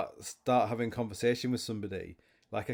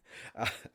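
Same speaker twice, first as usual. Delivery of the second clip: soft laugh; 5 dB quieter than usual. Real, not acted. Laughing at own joke.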